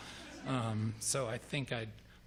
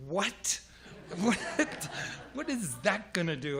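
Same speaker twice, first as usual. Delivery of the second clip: baffled; 7 semitones higher than usual. Retold or imitated. Baffled at the log.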